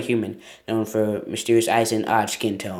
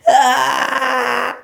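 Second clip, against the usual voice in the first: mock scream; 11 semitones higher, 5 dB louder than usual.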